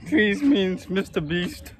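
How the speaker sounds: slow words broken by real laughter; laughing. Real, not acted. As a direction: squeeze the line out between laughs. Laughing through his answer.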